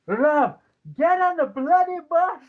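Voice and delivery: high, loud exasperated shout at the bus door; exasperated yell. Retold or imitated. Pat Patterson shouting at RVD to board, high and loud. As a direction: yell at him to get on the bus. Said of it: "He's just going".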